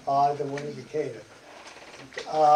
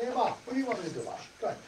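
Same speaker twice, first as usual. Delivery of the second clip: quick casual son; 4 semitones higher, 6 dB quieter, much faster than usual. Retold or imitated. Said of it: "I said".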